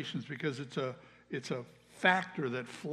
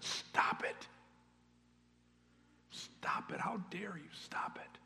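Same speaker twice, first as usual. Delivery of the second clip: harsh stage whisper; whispered scolding; 4 semitones higher, 6 dB quieter, slower than usual. Acted. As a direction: hush someone indignantly under your breath. Acted scolding of a crying beggar.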